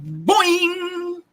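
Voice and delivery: high, springy boing; mock a sudden spring-up; high, springy comic sound effect; cartoon boing. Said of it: "he's just like".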